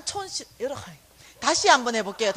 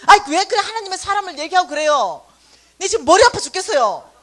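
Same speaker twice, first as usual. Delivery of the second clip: loud, pleading complaint; exasperated complaint; 4 semitones higher, 9 dB louder than usual. Retold or imitated. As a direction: whine it loudly. Manager complaining in distress. (머리가 아파 죽겠다고 크게 하소연)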